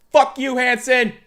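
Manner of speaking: loud, high, drawn-out shout; slow, furious acted holler; exasperated yell. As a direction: yell it across the yard at the prankster. Sergeant yells at the prankster.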